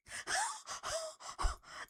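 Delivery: drawn-out, unvoiced gasp; shocked gasp. Real, not acted. Streamer reacting to a viewer's story, shocked gasp. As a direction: gasp at bad news.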